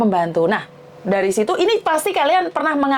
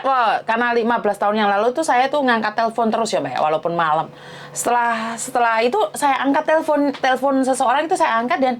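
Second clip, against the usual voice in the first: ordinary talk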